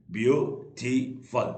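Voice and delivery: ब्यूटीफुल slowly with gaps, ब्यू, टी, फुल; three separate beats on ब्यूटीफुल; syllable split. Shown as a demonstration. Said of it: "तीन syllable".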